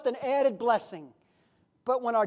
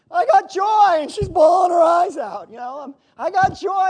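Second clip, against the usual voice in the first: loud, tearful shout of joy; overflowing joy; 5 semitones higher, 10 dB louder than usual. Real, not acted. Preacher tells the congregation about his joy, loud, tearful shout. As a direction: cry out your joy with tears breaking through. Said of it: "and I'm crying".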